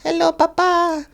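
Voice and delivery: put-on very high, drawn-out child voice; little boy voice. Burned little boy greets his papa, in a high child voice. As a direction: squeak it out like a tiny child. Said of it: "this little boy comes in".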